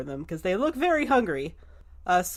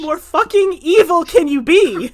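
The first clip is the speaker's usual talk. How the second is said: seeing red, voice climbing into a shout; outraged rant that explodes at the end; furious outrage; 8 semitones higher, 8 dB louder than usual. Acted. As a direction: build from disbelief to a high, loud outburst. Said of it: "oh, she sees red".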